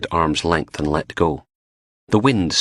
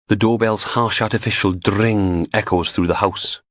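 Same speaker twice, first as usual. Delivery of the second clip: plain reading voice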